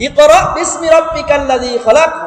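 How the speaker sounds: slow melodic Quranic chant; Quran recitation. Shown as a demonstration. Teacher reciting the verse to students, melodic chant.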